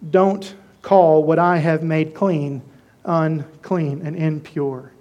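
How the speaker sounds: deep slow divine command; solemn voice of God. Acted. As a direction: speak down from heaven, weighty and calm. God's solemn rebuke to Peter.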